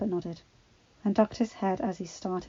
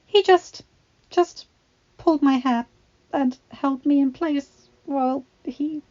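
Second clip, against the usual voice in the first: tearful; 6 semitones higher, 8 dB louder than usual. A narrator's reading. Belle tearful, recounting assault.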